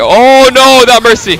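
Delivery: sudden high loud cry; startled yelp; alarmed outburst. Real, not acted. Sudden alarm at enemy Mercy.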